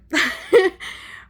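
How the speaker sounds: tearful high laugh; shaky sigh. Real, not acted. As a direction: laugh through held-back tears. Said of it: "I'm trying not to cry".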